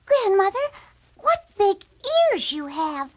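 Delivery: put-on a curious young girl; little girl voice. A narrator's reading. Girl staring at the disguised wolf, high. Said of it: "said the little girl".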